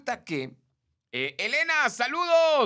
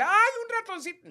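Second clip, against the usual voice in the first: high, loud panicked squeal at a mouse; hammed-up shriek; mock hysterical squeal; 5 dB louder than usual. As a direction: squeal like a frightened woman. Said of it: "no es así como que".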